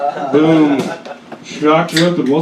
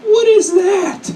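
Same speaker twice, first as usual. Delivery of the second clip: high drawn-out mock deaf mom; imitating his deaf mom catching him; slower than usual. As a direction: stretch it out slowly, high and puzzled. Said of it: "she's sighing to me like".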